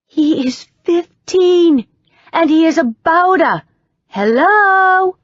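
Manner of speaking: high, loud and slow, hammering each point; exasperated. A narrator's reading. Andrea exasperated with her friend, high and loud, slowly hammering the obvious.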